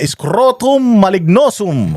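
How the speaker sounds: drawn-out, grandly intoned spell; mock magical incantation. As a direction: intone it like a hammed-up magic word. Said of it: "Ginagaya-gaya ko lang yung mga magical word mo". (kunwaring orasyon, pinahaba at madrama)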